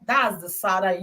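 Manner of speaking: usual speaking voice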